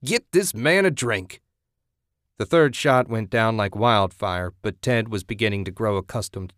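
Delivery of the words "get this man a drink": shouting. Acted. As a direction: bellow the order over the crowd. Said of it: "Jack shouted".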